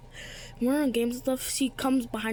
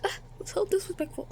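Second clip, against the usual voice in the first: mock crying; 9 semitones higher than usual. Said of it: "and I cried".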